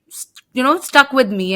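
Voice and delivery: ordinary talk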